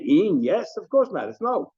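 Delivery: IN and YES lifted high as doubting questions; question mark. Shown as a demonstration. Teacher demonstrates the one word as question, incredulous tone. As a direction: swing YES up incredulously, then dismiss it. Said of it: "If it's a question mark".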